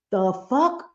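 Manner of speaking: ordinary talk, nothing marked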